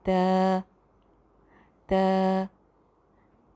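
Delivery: त said softly, tongue tip on the roots of the two upper teeth; a gentle light त, not a hard tap. Shown as a demonstration. Teacher showing students a soft त sound. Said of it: "ज़ुबान को सिर्फ ऊपर वाले दो दांतों की जड़ में लगा के बोलना है".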